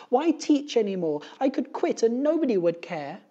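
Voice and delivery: very high, loud despairing cry; high-pitched, loud exasperation; exasperated teacher. A narrator's reading. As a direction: cry out like a teacher at the end of her rope. Teacher despairs of her job.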